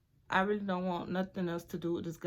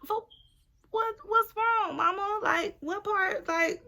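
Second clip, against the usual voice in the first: much higher, louder, slow incredulous; outraged disbelief; 11 semitones higher, 5 dB louder, slower than usual. Retold or imitated. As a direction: stretch it out in shocked disbelief. Retold outraged reply to ex.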